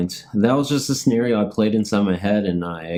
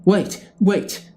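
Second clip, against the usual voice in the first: urgent call; 10 semitones higher than usual. Acted. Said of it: "the computer guy says".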